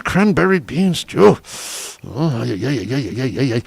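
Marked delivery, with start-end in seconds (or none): 2.0-3.6 savoring food. Acted.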